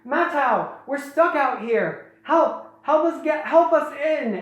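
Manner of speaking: high, pleading damsel voice; mock cry for help, pitched high; Nokama. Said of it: "it's Nokama's voice".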